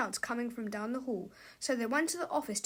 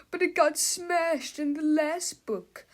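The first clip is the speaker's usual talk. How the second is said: sheepish; 5 semitones higher, 5 dB louder, slower than usual. A narrator's reading. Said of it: "said Mister Melvin sheepishly".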